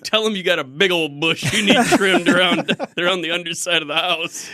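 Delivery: laughing through a mock reply; cracking up mid-sentence; giggling while acting it out. Mock advice to scammer, laughing.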